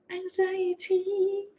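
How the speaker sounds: high, soft, drawn-out singsong; playful high-pitched wail, held long; mock anxiety. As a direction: stretch it high and thin, mocking your nerves.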